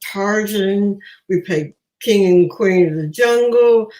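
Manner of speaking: ordinary talk, nothing marked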